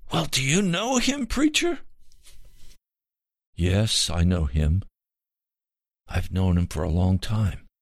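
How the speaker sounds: high, strained, desperate pleading. Acted. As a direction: beg like a frightened dying man. Dying man pleads to know God.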